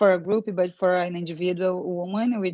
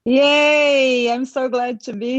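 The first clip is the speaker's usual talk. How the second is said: bright, loud burst of joy, pitched up; excited cheer; 5 semitones higher, 6 dB louder than usual. Real, not acted. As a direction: cheer as you arrive on the call. Greeting the group on the call.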